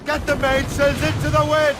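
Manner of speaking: high, carrying call to the crew; shouted order. Acted. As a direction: bellow the order across the deck.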